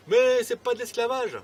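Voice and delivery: loud, high-pitched sarcastic denial; hammed-up indignant outcry; mock protest. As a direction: protest far too much, high and loud. Mocking those who deny slavery.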